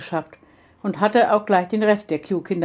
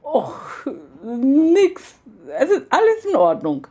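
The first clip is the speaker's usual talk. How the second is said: much higher, squeaky innocent denial; holding back a laugh; 6 semitones higher, slower than usual. Acted. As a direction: fight the giggles while playing innocent. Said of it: "sich ein Lachen zu verkneifen".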